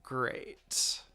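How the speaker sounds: breathy, defeated aside; dismayed whisper. Acted. Narrator realizes trouble, whispered dread. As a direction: sigh the word out, dreading it.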